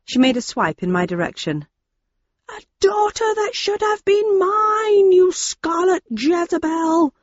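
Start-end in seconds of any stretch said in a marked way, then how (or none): 2.5-7.1 high, slow, raging screech; furious shriek. Acted. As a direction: screech it at her with fury. Daisy lunges with pipe.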